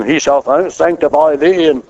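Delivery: mild pompous sanctimony; mock religious language. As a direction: parody stiff pulpit phrasing. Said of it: "sounds a whole lot more reasonable than".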